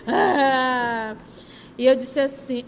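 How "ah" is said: loud imitated child's wail; imitating crying wail. Imitating the crying girl on the plane for the audience, loud wail. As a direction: mimic the girl's dry-throated crying. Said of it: "e a menina chorando".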